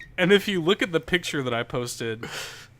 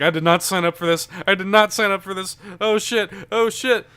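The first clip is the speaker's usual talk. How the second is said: loud, higher panicky protest; scared; 6 semitones higher, 7 dB louder than usual. Retold or imitated. Higher, louder scared wail. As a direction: girlfriend on the ride, panicking about the climb. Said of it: "She's like".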